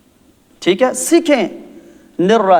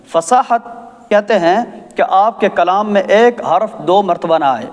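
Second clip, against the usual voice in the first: usual speaking voice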